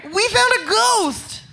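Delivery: high panicked cry for help; excited shout. Acted. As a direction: blurt it out breathless. Kids run to adult, panicked.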